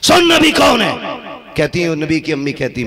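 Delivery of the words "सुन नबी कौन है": loud, much higher rebuke; angry shout. Shown as a demonstration. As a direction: shout the question at him. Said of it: "repeated, second shouted". (ऊँची, गुस्से भरी ललकार)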